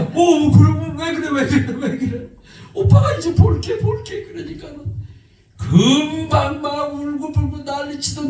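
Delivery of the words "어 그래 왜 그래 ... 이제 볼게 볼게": higher, gentle coaxing with a little laugh; calm her down, giving in; soothing. Retold or imitated. Brother giving in to his crying sister, soothing and reassuring.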